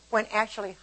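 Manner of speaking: ordinary delivery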